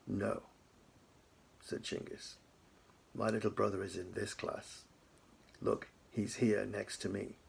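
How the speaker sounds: low, quiet and firm; quiet, stubborn boy refusing. A narrator's reading. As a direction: hold your ground calmly. Chinggis voiced low and quiet.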